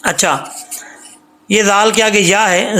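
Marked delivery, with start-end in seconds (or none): none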